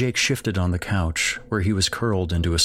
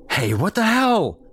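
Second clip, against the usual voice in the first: very high, loud angry shout; dad shouts; 13 semitones higher, 4 dB louder than usual. Acted. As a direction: bellow it, much higher and louder. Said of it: "Jake's dad shouted".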